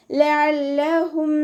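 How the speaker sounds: ലഅല്ലഹും with ع from mid-throat, slow chant; tajweed recitation. Shown as a demonstration. Teacher models the word for students, slow chant. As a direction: recite ലഅല്ലഹും high and drawn. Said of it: "തൊണ്ടയുടെ മധ്യഭാഗത്തുനിന്ന്".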